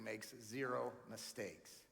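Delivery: ordinary delivery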